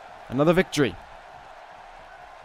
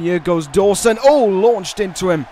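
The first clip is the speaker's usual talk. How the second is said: excited call; 4 semitones higher, 7 dB louder than usual. Real, not acted. Live call of a big move.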